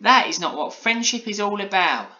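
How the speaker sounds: put-on high, loud child's telling-off; Polly, firm. A narrator's reading. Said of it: "said Polly firmly".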